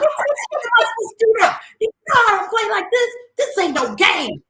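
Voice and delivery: high, loud mock rant; acting out her fed-up rant; retold angry outburst. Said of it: "I was cussing".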